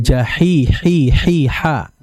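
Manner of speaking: jahi, hi, hi, ha, the h breathed out; h sound drill. Shown as a demonstration. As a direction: breathe out each h. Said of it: "hati-hati di situ".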